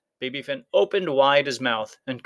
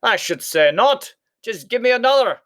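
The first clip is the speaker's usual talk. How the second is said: loud, high demand; blustering shout; 10 semitones higher, 5 dB louder than usual. A narrator's reading. Giant demanding more bread, loud blustering. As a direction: bark it out proudly. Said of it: "shouted Cuchulainn".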